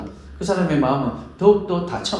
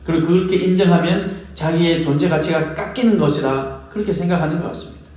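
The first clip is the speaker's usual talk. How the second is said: ordinary delivery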